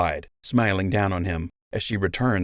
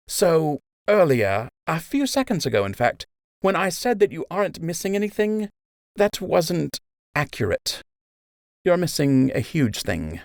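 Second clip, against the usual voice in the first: higher, kind, reassuring healer's voice; nurse Emma; 10 semitones higher than usual. Acted. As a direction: soothe the wounded soldier gently. Emma admits the truth.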